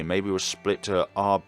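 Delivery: usual speaking voice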